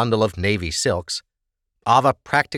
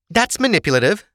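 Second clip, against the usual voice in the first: ordinary delivery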